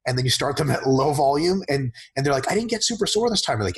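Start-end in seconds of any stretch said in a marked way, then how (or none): 2.5-3.6 much higher, lightly voiced disappointed client. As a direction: pitch it up like a puzzled newbie.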